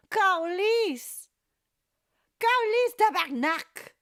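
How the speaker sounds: high, loud mock Québécois cursing; playful swearing shout. Streamer showing off learned Quebec swearing, playful shout. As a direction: belt out the swear words for show.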